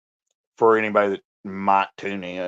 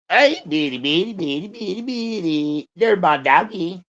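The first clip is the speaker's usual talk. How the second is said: doggy talk; 6 semitones higher than usual. Real, not acted. Owner cooing at his dog Bitty, high baby talk. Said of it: "I'm talking to my dog".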